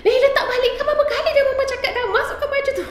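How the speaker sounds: shrill high, loud, fast mock nag with a laugh; mother nagging child about dirty clothes, laughing. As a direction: ham up a scolding mum. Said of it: "Kalau kita nak suruh je".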